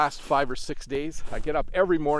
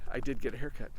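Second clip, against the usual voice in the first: ordinary delivery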